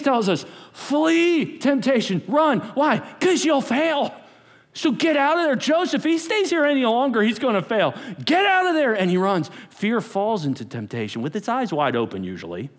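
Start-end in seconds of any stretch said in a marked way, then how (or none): 0.7-8.9 full-force preaching. Real, not acted. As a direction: drive it home from the pulpit.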